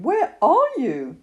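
high, drawn-out call; crying out. A narrator's reading. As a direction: call slowly for a missing friend. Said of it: "cried Christopher Robin".

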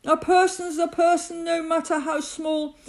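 high, impassioned call; rally the small folk earnestly; calling out encouragement. A narrator's reading. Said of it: "he managed to call to the mayor".

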